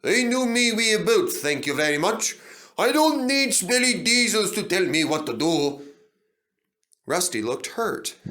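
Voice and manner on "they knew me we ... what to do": huffy and loud; scornful grumble. A narrator's reading. Duncan dismissing Rusty's warning, scornful. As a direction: sneer at the diesel. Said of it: "he grunted".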